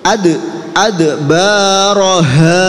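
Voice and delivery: "Ad" with bouncing d, "baroha" slow and loud; qalqalah. Shown as a demonstration. Teacher leads a repeat-after-me drill, qalqalah on "Ad". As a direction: bounce "ad", then draw out "baroha". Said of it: "Nggih, qalqalah".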